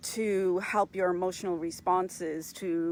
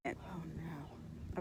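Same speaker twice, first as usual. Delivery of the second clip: very soft, whispered oh no; dismayed murmur; 2 semitones lower, 15 dB quieter than usual. Real, not acted. Host to herself as the live connection falters, dismayed whisper.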